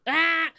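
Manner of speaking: put-on short sharp baby yell, high and loud; imitated baby shout. Mother imitating her son's shouting, loud yell. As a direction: mimic the baby's loud shout.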